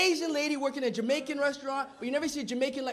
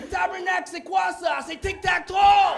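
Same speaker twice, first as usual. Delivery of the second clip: high, excited Quebecois exclamation; Quebecois Frenchman; 5 semitones higher, 5 dB louder, slower than usual. Acted. Comic plays a Frenchman seeing three X's, high Quebecois shout. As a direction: cry out like a Quebecois, building to a loud finish. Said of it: "A Frenchman sees three X's".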